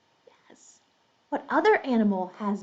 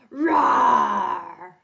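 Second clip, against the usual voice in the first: big rough lion's roar; 3 dB louder than usual. Pretend. Teacher shows children the story cue, big lion roar. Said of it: "when you hear lion".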